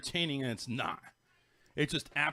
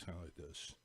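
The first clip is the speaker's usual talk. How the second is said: flat, lower, much softer drone; monotone voice; 8 semitones lower, 17 dB quieter than usual. Shown as a demonstration. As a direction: drone it out with no life. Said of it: "talks in a monotone voice".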